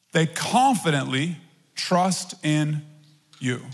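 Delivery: slow, reverent reading of the verse; scripture reading. Acted. Preacher reads the verse to the church, slow and reverent. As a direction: read each word slowly and with weight. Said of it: "put that up in the Passion Translation".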